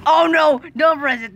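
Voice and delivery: urgent cry of warning; panicked warning. Real, not acted. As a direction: shout to stop them in time. Panic at danger in game.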